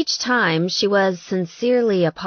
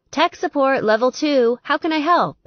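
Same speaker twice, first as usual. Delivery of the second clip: higher help-desk phone greeting; Taylor's light voice; 7 semitones higher, 3 dB louder than usual. A narrator's reading. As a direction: answer the line like tech support, higher. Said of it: "Her light voice came on the line".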